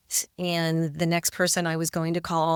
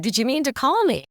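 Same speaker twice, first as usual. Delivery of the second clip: higher, quick chipper phone greeting; 7 semitones higher, faster than usual. Retold or imitated. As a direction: ask it brightly, pitched up and brisk. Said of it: "kind of chipper".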